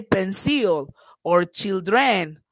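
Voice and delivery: put-on "pencil or children" as pen-CIL, chil-DREN; wrong final stress. Shown as a demonstration. Teacher showing what she doesn't say, stress on the endings. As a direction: push the last syllable of "pencil" and "children". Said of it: "I don't say".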